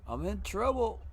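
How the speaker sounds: high-pitched whiny wail; high whine. Real, not acted. Repeated, high.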